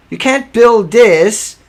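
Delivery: each word pressed out slowly and firmly; slow emphatic insistence. Real, not acted. To viewers about the reactor, slow hammering emphasis. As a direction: lean hard on build, drag it out.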